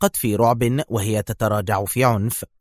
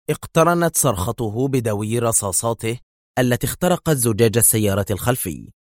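ordinary delivery

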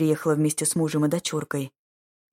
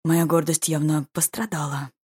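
ordinary delivery, nothing marked

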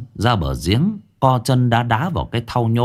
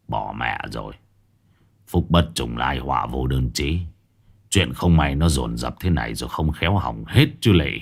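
weary dismay; 7 semitones lower, 3 dB quieter than usual. Acted. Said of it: "Ông ngao ngán".

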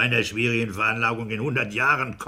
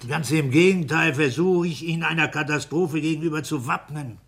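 higher and louder, defending himself; raised, insistent self-defence; heated insistence; 8 semitones higher, 6 dB louder than usual. Acted. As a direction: push back hard, voice raised.

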